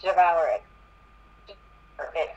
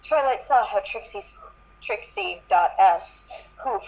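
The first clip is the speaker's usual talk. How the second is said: ordinary talk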